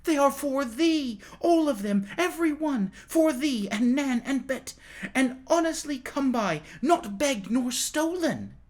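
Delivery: put-on high, joyful boy's cry; cried out. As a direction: burst out with the good news. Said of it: "and cried out".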